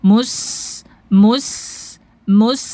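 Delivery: MUS with a long, sharp hissed S; sharp flowing sin. Shown as a demonstration. Teacher drilling students, sharp flowing sin. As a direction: sustain the whistle of S in MUS. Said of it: "Tajam suaranya".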